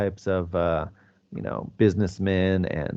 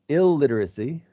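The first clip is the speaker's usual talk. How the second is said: ordinary delivery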